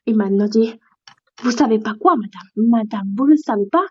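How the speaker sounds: ordinary talk, nothing marked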